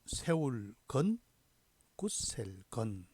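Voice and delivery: slow, drawn-out reading of a character list; rote recitation. Shown as a demonstration. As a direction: chant each gloss and reading slowly, pausing between entries. (한자 훈음을 길게 늘여 또박또박 암송하듯)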